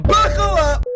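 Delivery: high, loud hype call; hyped up. Acted. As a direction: pump up the viewers.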